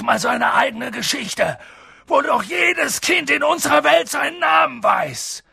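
indignant, emphatic outburst; Hagrid thundering. A narrator's reading. Giant furious that Harry knows nothing, indignant booming. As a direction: rage at the scandal of it. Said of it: "donnerte Hagrid und sprang so wütend auf".

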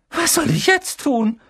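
put-on shrill panicky girl. A narrator's reading. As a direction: cry out, locked out. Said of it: "mit schriller Stimme".

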